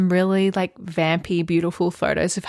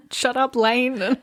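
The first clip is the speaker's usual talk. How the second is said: teasing; 5 semitones higher, slower than usual. Real, not acted. Teasing the author.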